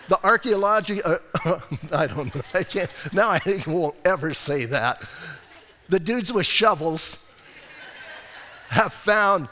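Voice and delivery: chuckling through a fumbled word; real amused laughter through the words; laughing at himself. Real, not acted. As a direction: laugh it off while stumbling over the word. Pastor laughs at own stumble.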